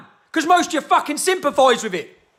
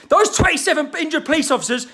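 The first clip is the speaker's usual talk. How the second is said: usual speaking voice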